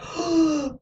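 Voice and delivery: imitating wide-eyed wonder; long, high intake of breath; awed gasp. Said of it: "you see him almost like".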